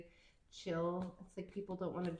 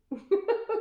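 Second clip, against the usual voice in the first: burst of laughter; 10 dB louder than usual. Real, not acted. Laughing at own joke.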